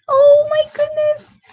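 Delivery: high, loud oh my goodness; mock amazement. Teacher hams up shock for the children. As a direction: gasp it out in big surprise. Teacher reacts to silly house.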